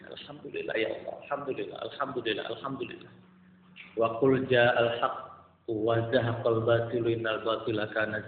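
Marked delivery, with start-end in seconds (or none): 0.1-3.1 breathy whisper, fast then fading; hushed repeated thanks fading out; whispered praise. Real, not acted. Whispered thanks after police news.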